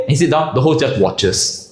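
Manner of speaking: ordinary delivery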